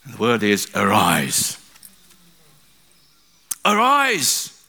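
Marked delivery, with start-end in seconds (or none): 3.7-4.6 high, ringing call to rise; captain's command. Retold or imitated. Captain waking Jonah, calling out commandingly. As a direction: order the sleeper up as the captain. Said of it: "he said, the captain".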